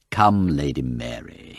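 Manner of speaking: slow, coaxing gentle reproof. Acted. Parker gently reprimanding Lady Mary, encouraging and slow. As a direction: coax her like a fanciful child. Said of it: "he said encouragingly".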